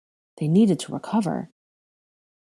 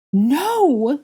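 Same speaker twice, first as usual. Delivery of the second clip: high, loud scream; horrified high scream; anguished cry; 9 semitones higher, 6 dB louder than usual. A narrator's reading. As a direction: scream in horror. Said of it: "Ginny cried".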